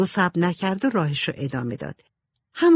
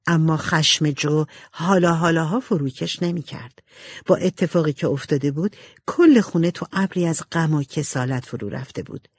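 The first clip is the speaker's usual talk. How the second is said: ordinary delivery, nothing marked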